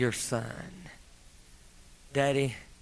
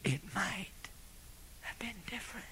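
whisper; 7 semitones higher, 13 dB quieter than usual. Real, not acted. Whispered repeat.

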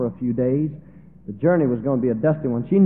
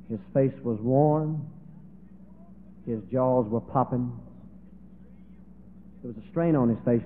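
normal talking voice

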